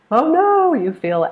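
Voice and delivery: high, loud "oh no"; anxious mock alarm. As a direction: act out being stressed out. Acting out anxious stress.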